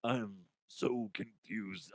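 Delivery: mock dazed mumble; mumbled confusion. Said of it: "And I'm gonna be like".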